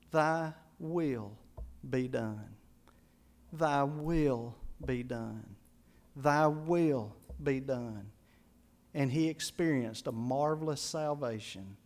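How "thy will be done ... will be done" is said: lower, softer, slow and tearful; a grieving father finally giving in; broken father. Retold or imitated. Father at bedside in tears.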